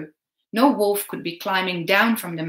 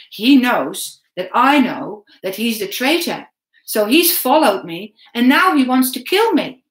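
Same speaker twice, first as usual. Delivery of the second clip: louder and louder frightened thought; rising fear; 4 semitones higher, 8 dB louder than usual. A narrator's reading. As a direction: panic builds toward the end. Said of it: "I began to shake".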